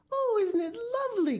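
very high, fast and thrilled; boy crying out in delight while flying; cried with delight. Acted. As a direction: gush with joy. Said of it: "cried William".

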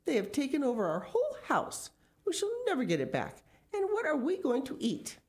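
high-pitched wailing complaint; mother in a fluster, despairing; dismayed cry. Acted. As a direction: lament it with the voice held high. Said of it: "cried Missus Gregg".

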